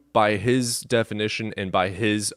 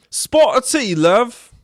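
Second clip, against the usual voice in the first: high, loud cartoon British voice; hammed-up British tea offer; British accent; 13 semitones higher, 7 dB louder, slower than usual. Host showing off bad voices, mock British. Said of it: "it sounded British".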